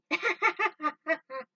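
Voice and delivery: high, loud mocking laugh; gloating laugh. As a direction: laugh at the crew you just robbed. Rival gloats over stolen loot.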